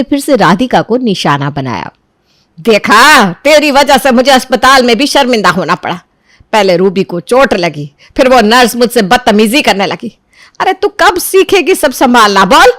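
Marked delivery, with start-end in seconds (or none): 2.6-12.7 rising anger. Acted. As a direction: let the pent-up rage spill out. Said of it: "अंदर ही अंदर उसका गुस्सा बढ़ता जा रहा था".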